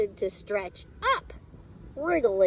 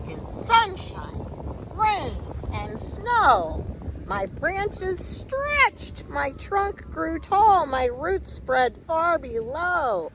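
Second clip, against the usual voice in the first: 1 slow drawn-out; 7 semitones higher, slower than usual. A narrator's reading. Tree growing, stretched slowly.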